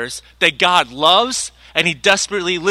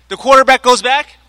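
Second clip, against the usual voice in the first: higher, louder restart so all can hear; louder, higher retake after being unheard; louder repeat; 5 semitones higher, 6 dB louder than usual. Real, not acted. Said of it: "sorry, you can't hear me".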